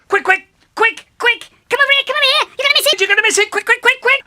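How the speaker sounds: very high, loud, rapid urgent squeals; hammed-up high frantic call. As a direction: squeak it out like he can't wait. Brother calls him over urgently.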